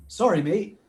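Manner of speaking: bad Australian accent, lightly done; a hint of Aussie; terrible Australian accent. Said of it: "I say in my terrible Australian accent".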